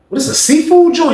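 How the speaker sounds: high, puzzled disbelief; confused question. Retold or imitated. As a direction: ask it like you've never heard of it. Storyteller voicing himself, confused.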